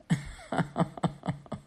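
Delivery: breathy run of chuckles; amused laugh. Real, not acted. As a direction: laugh it out after the joke. Amused by own divine voice.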